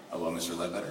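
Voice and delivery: low, soft, businesslike hello; composed phone greeting. Retold or imitated. Salesman answering an important callback, composed and low.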